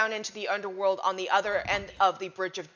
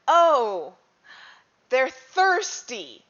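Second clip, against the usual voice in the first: higher, louder, slow dawning realization; 6 semitones higher, 9 dB louder, much slower than usual. Acted. Realizing what the coins mean, slow dawning surprise. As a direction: let the penny drop slowly. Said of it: "and I'm like".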